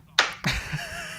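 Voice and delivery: high-pitched, quiet laugh; laughing at himself. Real, not acted. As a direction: laugh at your own words in a high squeal. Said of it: "why are you laughing at yourself".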